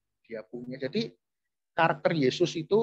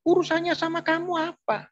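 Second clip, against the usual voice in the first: mocking a cheating man, pitched much higher and louder; defiant cheating husband; 6 dB louder than usual. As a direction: play the brash guy brushing off advice, high and pushy. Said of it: "Ngomongnya begini". (meniru suami selingkuh yang ketus, suara jauh lebih tinggi)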